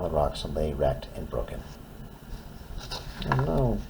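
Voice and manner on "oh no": ordinary delivery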